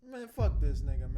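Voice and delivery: loud, disgusted cuss at the screen; disgusted outburst. Real, not acted. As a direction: spit it out in disgust. Disgusted reaction to apology.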